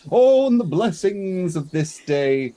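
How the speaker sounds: high, loud grand proclamation; grand oratory. Acted. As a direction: declaim it grandly, like a ceremonial blessing. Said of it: "in a very grand oratory voice".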